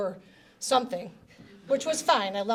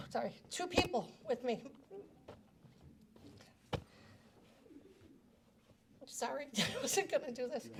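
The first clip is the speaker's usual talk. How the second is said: choked up; 12 dB quieter than usual. Real, not acted. Breaks down mid tribute.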